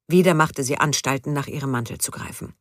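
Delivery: usual speaking voice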